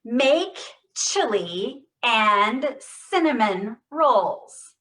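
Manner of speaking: slow, loud, every sound exaggerated; over-exaggerated stress. Shown as a demonstration. Therapist leading a Parkinson's voice drill, exaggerated stress. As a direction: stretch and punch each word. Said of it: "over exaggerate".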